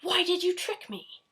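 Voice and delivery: high, loud furious witch; angry yell. A narrator's reading. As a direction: yell at the cat in rage. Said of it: "yelled Bony Legs".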